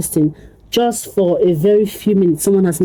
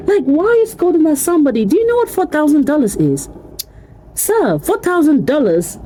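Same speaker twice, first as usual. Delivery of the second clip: outraged; 7 semitones higher than usual. Real, not acted. Outraged at the pastor.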